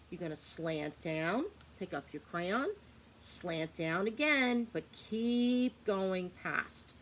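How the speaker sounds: "slant down again" drawn out slowly; slow step-by-step instruction. Shown as a demonstration. Teacher slowly guides the lowercase y stroke. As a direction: stretch each stroke word out. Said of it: "show you again how we make".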